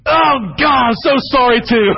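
high, loud overjoyed sorry; high emotional shout; gushing apology. Retold or imitated. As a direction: cry it out in relief. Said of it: "he goes".

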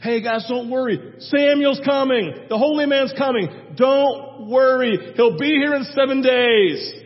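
loud, overconfident king calming his men; hammed-up loud reassurance; reassuring Saul. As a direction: boom out don't worry to the troops. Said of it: "And Saint Saul's like".

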